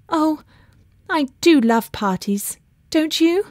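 mildly breathless delight, lifting high at the ends; a touch of excited gasping; gasped. A narrator's reading. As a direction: gush it with a little gasp. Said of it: "gasped Laura".

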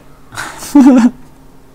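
high, loud burst of laughter. Real, not acted. Laughs at a viewer's joke.

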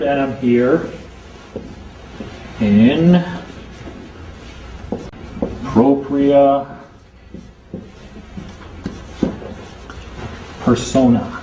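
each word of "propria persona" dragged out with long pauses; slow, weighty Latin. Shown as a demonstration. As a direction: drop the Latin in like a key phrase, pausing between words. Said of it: "I am here in the proper person".

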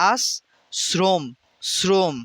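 আশ্রম slowly broken as "as... srom"; syllable-by-syllable dental s. Shown as a demonstration. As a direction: hold the s of আশ্রম, then repeat "srom". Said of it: "স এর নিচে হসন্ত দিতে হবে". (আশ্রম ভেঙে "আস্... স্রোম্")

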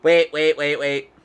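urgent rapid waits; frantic. Real, not acted. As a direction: cut in urgently. Frantic interruption.

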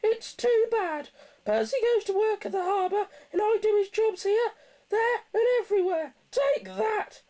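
very high, loud squawking complaint; indignant snorting. A narrator's reading. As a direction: rant in a loud, high-pitched character voice. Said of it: "James was snorting about in the yard".

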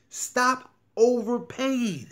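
loud, slow and high, hammering it home; a hard-sell ad cry; emphatic shout. Real, not acted. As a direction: slam the point down slowly. Pushing viewers to stop overpaying.

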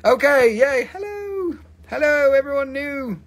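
playful high-pitched cheer; excited squeal. Pretend. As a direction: greet everyone in a high, gleeful voice. Playful greeting during dog time.

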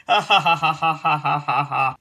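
loud ha ha laugh fading off. Real, not acted. Laughing at Jemmy's purple frog drawing, loud ha ha laugh. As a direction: let a loud ha ha ha roll out and tail off.

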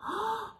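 quick surprised gasp; sharp gasp. A narrator's reading. Reader gasping as the huge fish bites. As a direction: gasp as the hook jerks. Bear hooks something huge.

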